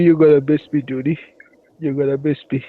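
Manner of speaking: usual speaking voice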